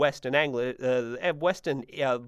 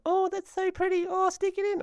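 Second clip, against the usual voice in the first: high-pitched mock girl voice; admiring girls. Girls at uni commenting on his handwriting, admiring girl voice. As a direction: imitate gushing girls, pitched way up. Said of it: "girls would comment".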